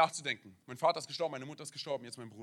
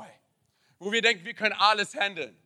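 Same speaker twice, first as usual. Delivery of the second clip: ordinary talk, nothing marked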